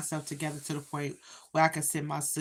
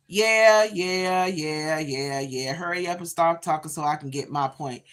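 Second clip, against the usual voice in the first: impatient; 7 dB louder than usual. Retold or imitated. Mocking an impatient listener.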